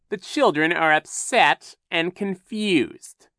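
high, loud, slow villain; drawn-out show of false concern; Captain Sham, feigned concern. A narrator's reading.

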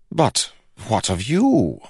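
high, slow, troubled question; pressing king. A narrator's reading. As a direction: press it slowly as a disturbed king. King presses Kell on death.

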